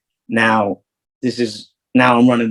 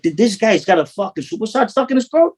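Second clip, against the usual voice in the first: high, sarcastic disbelief; mocking a mobster's accent; incredulous mockery; 11 semitones higher than usual. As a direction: scoff at how the guy talks. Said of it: "I'm like".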